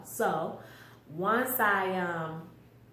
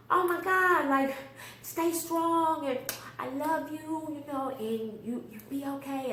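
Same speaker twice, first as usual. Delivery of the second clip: emotional call out; 7 semitones higher than usual. Retold or imitated. Mother calling to son in police car.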